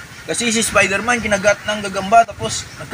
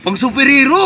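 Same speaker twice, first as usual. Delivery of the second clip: high, loud thrilled cry; excited shout; 9 semitones higher, 8 dB louder than usual. Acted. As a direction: shout it out in pure delight. Thrilled at finding superhero gear.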